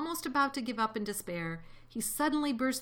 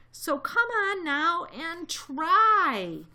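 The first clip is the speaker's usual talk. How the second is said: loud, high, drawn-out exhortation; calling out; 7 semitones higher, 7 dB louder, much slower than usual. A narrator's reading. Elephant urging townspeople to try, calling out. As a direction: stretch it into a rallying cry. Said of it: "he managed to call to the mayor".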